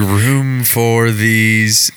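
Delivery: very slow, drawn-out punchline; relishing the joke; savored punchline. Retold or imitated. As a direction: stretch out each word slowly.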